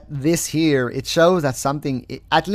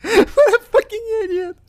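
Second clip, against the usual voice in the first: much higher, laughing through the words; squeaky laughing disbelief; laughing insult; 6 dB louder than usual. Real, not acted. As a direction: crack up as you insult him. Laughing at defendant's lawsuit.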